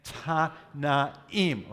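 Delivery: "Tanaim" very slow, in three separate beats, Ta, na, eem; syllable-by-syllable repeat. Shown as a demonstration. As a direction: lead the class through "Tanaim" slowly, each syllable apart.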